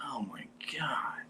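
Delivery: hushed, slow mock whisper; whispered aside in slow disbelief; awed whisper. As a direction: breathe it out slowly, stunned, under your breath. Awestruck inner reaction at the table.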